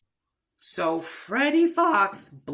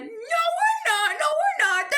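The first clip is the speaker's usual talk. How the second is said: high squealed play-acted protest; very high, mock-furious whine; losing it; 4 dB louder than usual. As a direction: squeal it back like a cartoon kid mid-quarrel. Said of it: "Susie loses it".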